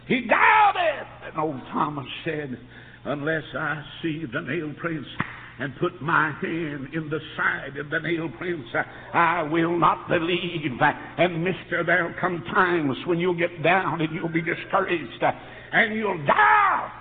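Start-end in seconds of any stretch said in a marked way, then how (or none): 0.0-1.1 high, loud accusing cry; sudden emphatic pulpit shout; preaching shout. Real, not acted. Preacher hammers the refrain. 3.0-16.9 slow, weighty pulpit cadence; emphatic preaching. Real, not acted. Preacher builds quotation into exhortation.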